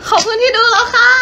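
high, giggly idol thank-you; sweet polite thanks. Shown as a demonstration. Idol showing fans the cute high way to say thanks. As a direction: thank the viewers cutely through a laugh. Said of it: "ก็ต้องพูดว่า".

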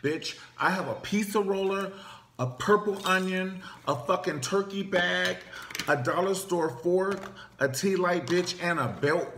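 high, loud, fired-up list of weapons; comic battle-ready rant; hyped-up threat. Pretend. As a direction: rattle off weapons ready to brawl. Ready to fight for cousin.

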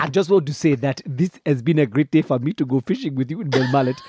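put-on comic Nigerian accent, formally polite; Nigerian prince accent. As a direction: play a Nigerian prince making a little speech. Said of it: "Do a, do the Nigerian".

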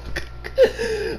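quiet, high giggle; soft high giggle. Real, not acted. Fan giggling over her French accent, soft giggle. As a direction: giggle to yourself. Gushing about favorite character.

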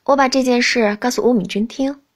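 plain reading voice

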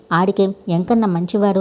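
usual speaking voice

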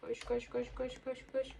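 soft, rhythmic chant under the breath; hushed urging. Real, not acted. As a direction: urge yourself along very quietly. Oyunda koşarken kendi kendine tekrarlıyor. (alçak sesle tempolu mırıldanma)

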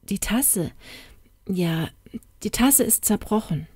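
soft, halting confession with pauses; sheepish and hushed, stalling between phrases; embarrassed. A narrator's reading. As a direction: own up quietly, a little at a time. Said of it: "Diese errötete verlegen".

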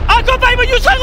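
excited shout. Real, not acted. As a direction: call the player's name in thrill. 선수 이름을 외치는 중계. (흥분한 외침)

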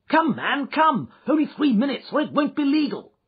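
high, loud, insistent pleading; urgent shouting. A narrator's reading. Bridegroom rushing a witness before time runs out, shouting. Bridegroom urging stranger to altar.

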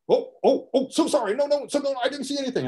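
high, flustered, fake-innocent apologies; flustered apologetic custodian. Custodian walking in on the fight to the drug pusher, flustered high apology. Custodian pretending he saw nothing.

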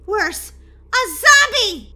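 high, loud dramatic cry of zombie; alarmed shout. Acted. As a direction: blurt it out in mock terror. Reveals zombie sighting.

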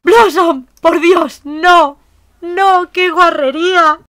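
very high, loud shrieks of disgust; piercing yells of disgust; shocked scream. Real, not acted. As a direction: scream in real horror. Sim faints, mess on floor.

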